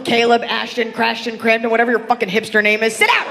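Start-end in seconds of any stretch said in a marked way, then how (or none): 2.9-3.2 sudden high yell; shouted order. Acted. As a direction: bark it at the kid up front. Yelling at hipster kid.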